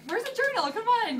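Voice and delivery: high-pitched playful imitation of boyfriend; mock-impatient, high voice; eager pestering. Acting out boyfriend eagerly pestering her.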